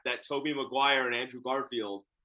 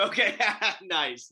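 laughing; 6 semitones higher, 3 dB louder, slower than usual. Real, not acted. Host laughs at costume.

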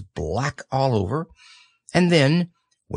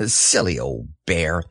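a low chuckle on the words; fond little laugh under the breath; laughing to yourself; 6 semitones lower than usual. Acted. Christopher Robin laughing fondly at Pooh, low chuckle. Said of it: "you laughed to yourself".

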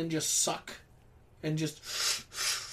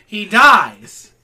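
loud declaration; 3 semitones higher, 17 dB louder than usual. Real, not acted. Loud repeat.

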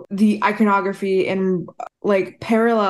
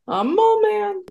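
mole man voice; 11 semitones higher than usual. Pretend.

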